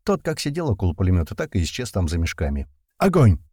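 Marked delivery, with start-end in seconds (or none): 3.0-3.4 short, high, loud order to fire; barked command. A narrator's reading. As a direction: shout it as a sharp combat command. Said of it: "Отдал я короткую команду".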